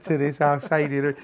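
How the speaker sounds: ordinary delivery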